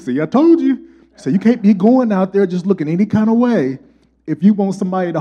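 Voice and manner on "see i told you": high, loud told-you-so; father proud he was right; gloating dad. Retold or imitated. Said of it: "He said".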